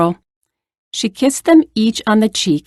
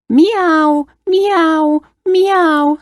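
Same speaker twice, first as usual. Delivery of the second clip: high meows falling at the end; screeching cat; 8 semitones higher, much slower than usual. A narrator's reading. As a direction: imitate an upset cat. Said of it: "screeched the cat".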